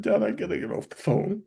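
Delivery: high, choked retelling of a distraught daughter; hysterical, retold tearfully. Daughter to her father on goodbye call, retold choked and hysterical. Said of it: "And she was hysterical".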